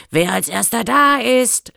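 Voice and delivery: calling out. A narrator's reading. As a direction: call out the challenge as a boar boy. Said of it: "rief er". (rufend, als Aufforderung zum Wettlauf)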